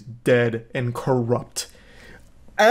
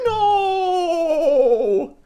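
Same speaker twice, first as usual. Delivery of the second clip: high, hammed-up wailing no; mock desperate wife; panicked no; 13 semitones higher than usual. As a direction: wail it like a frantic wife. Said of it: "She's like".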